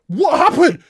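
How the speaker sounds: exasperated. Real, not acted. Exasperated high question.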